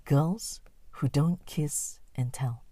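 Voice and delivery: ordinary delivery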